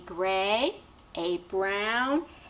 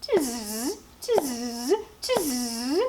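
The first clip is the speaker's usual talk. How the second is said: buzzy, drawn-out monkey noise, rising at the end; mock him like monkeys, in buzzing hisses; monkey chatter; much slower than usual. A narrator's reading. Monkeys shake fingers back at the peddler, mocking chatter. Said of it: "the monkeys ... said".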